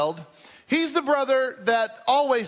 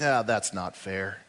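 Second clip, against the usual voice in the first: muttered grumble; 11 semitones lower, 4 dB quieter, slower than usual. Acted. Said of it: "it's like".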